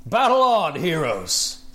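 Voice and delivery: building, rising announcer call; arena announcer. Acted. Announcer introduces Gormok the Impaler.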